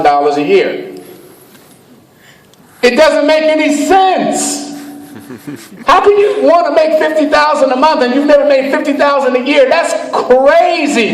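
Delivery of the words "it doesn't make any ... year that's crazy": high-pitched mock disbelief; incredulous doubter. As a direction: squeal in exaggerated disbelief at a crazy claim.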